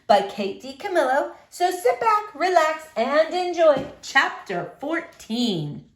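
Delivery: bright, lilting hello to the class; sing-song classroom hello; cheery teacher greeting. Acted. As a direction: welcome the kids in warmly. Teacher greeting class before reading.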